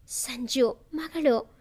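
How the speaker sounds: ordinary delivery, nothing marked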